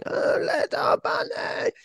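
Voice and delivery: high, slow wailing whimper for the lost toy; mock toddler crying. Toddler crying for her lost bunny, high mock wail. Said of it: "crying her eyes out, going".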